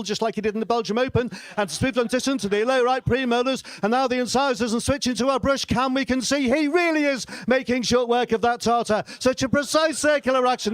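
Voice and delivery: high-pitched, excited live commentator; sports commentary. Acted. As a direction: call toothbrushing like a thrilling race. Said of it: "gotta have the right commentary".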